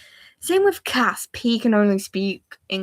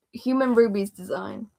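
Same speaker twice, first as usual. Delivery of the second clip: ordinary talk, nothing marked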